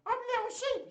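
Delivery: very high, squeaky puppet voice; high-pitched toy sheep squeal; Little Sheep. Pretend. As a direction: pipe up as a tiny excitable sheep. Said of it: "in Little Sheep's voice".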